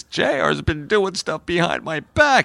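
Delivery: high-pitched, hammed-up complaint; mock whining. Bobby tattling on his brother to his dad, mock whining. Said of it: "he goes whining to him".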